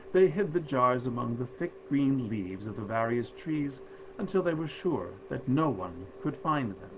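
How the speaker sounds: plain reading voice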